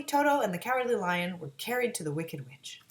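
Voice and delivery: usual speaking voice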